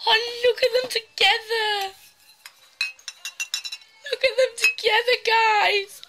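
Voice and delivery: high, gushing look at them; excited squeal. Real, not acted. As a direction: squeal over cute decorations. Gushing over the set.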